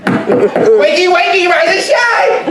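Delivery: very high, sung-out wakey wakey; cheery wake-up call. Acted. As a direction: sing them out of bed.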